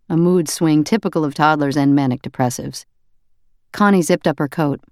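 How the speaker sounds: usual speaking voice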